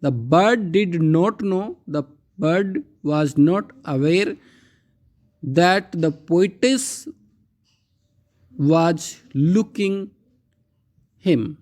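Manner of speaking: slow, deliberate recitation of each word; slow poem reading. Acted. Teacher slowly reciting a Dickinson poem line for the class. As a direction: read the verse slowly to the class.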